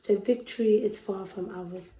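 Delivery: ordinary delivery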